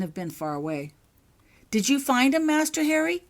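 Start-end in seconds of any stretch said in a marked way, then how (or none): 1.7-3.2 higher, louder anxious asking; a worried elf pleading for news. A narrator's reading. The elf asks Harry about the missing, anxious and higher. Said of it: "he asked anxiously".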